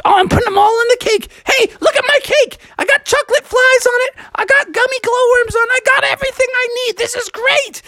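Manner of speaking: high, rapid-fire excitement; sugar-happy Froggy voice; gleeful old frog. Acted. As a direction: brag happily about the cake.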